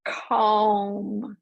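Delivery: "calm" stretched long and slow; slow "calm" out of the hard k; drawn-out calm. Shown as a demonstration. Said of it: "long, slow slowing down of that ka sound".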